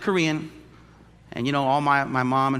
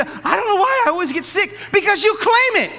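high, loud laughing retort; laughing preaching; 8 dB louder than usual. Acted. Pastor mocking believers who claim sickness, laughing loud retort. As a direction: fire back with a laugh.

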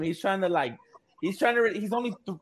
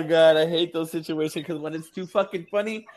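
ordinary talk, nothing marked

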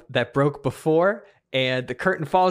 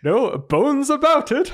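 hammed-up pun; 11 semitones higher, 5 dB louder, slower than usual. Punchline pun.

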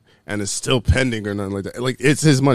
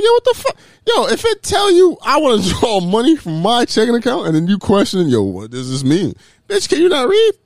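higher and louder, an incredulous, exasperated rant at the clerk; mock outraged rant; 8 semitones higher, 5 dB louder than usual. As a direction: blow up at the clerk like you can't believe it. Acting out his angry retort to the clerk.

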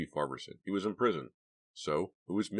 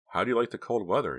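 blurted; 7 semitones higher, 6 dB louder than usual. A narrator's reading. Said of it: "Dina blurted out".

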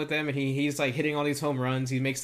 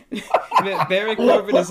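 loud, cracking up mid-name; real laughing; 4 semitones higher, 9 dB louder, much slower than usual. Real, not acted. Introducing his guy Baron Corbin, real helpless laughing. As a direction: burst out laughing and restart the name.

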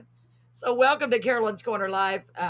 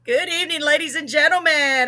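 big showman welcome, raised and louder; announcer voice; 5 semitones higher, 5 dB louder than usual. Acted. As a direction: open the show like a stage host. Host opens show, announcer voice.

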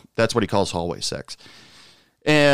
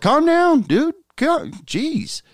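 high mock-exasperated, calm down dude; high-pitched mock exasperation at the eager guy; 3 dB louder, much slower than usual. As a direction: wave him off, way up high. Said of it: "I was just like".

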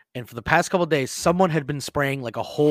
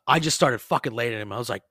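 usual speaking voice

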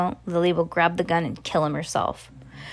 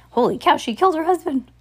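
shocked aside; 10 semitones higher, 5 dB louder, faster than usual. Real, not acted. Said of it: "reader's shocked aside".

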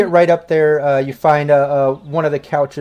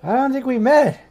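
put-on drunk girl voice; 10 semitones higher than usual. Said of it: "and she's like".

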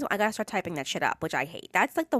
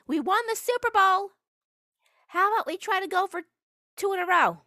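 much higher, sneering take on the player's voice; mock gloating; 9 semitones higher than usual. Mocking the player who just won the Super Bowl, sneering. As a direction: lampoon a smug champion.

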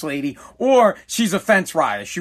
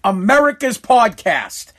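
higher, slow, emphatic show opener; podcast intro; 4 semitones higher than usual. Acted. Host welcomes listeners to America's podcast, announcer-style intro. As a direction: announce the show with drawn-out key words.

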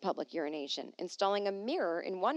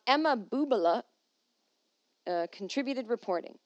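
usual speaking voice

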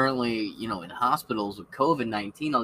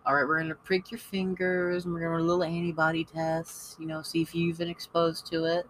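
higher, sing-song nurse voice explaining to a patient; visiting nurse; 6 semitones higher than usual. Retold or imitated. Nurse explaining the blood test to the household, higher sing-song voice. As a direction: play the chipper nurse breaking news gently. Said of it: "Lily's visiting nurse comes and goes".

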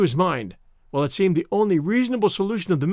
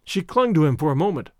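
usual speaking voice

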